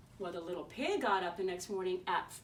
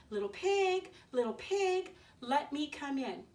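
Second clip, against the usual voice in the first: wolf voice; 5 semitones higher than usual. Acted. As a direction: call sweetly at the door like a wolf. Wolf calling at pig's door.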